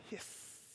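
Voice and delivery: soft voiceless snake hiss; breathy imitated hiss. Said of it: "you hear the distinctive hiss".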